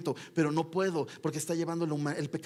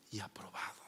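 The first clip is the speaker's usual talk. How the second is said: softer whispered ending; hushed close of the phrase; 9 semitones lower, 14 dB quieter than usual. Acted. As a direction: let "y aprobado" fall to a whisper. Sermon aside whispered.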